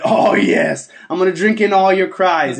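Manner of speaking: loud, hammed-up villain relish; orgasmic villain.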